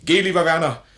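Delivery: laut und höher, drängend; erregtes Fortschicken. Acted. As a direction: schickt ihn eilig fort. Major schickt Werner erregt fort. (lautes, erregtes Fortschicken)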